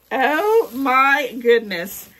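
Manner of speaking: loud, high, long-drawn amazement; amazed exclamation. Real, not acted. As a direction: exclaim it slowly, bowled over. Stunned on opening another gift.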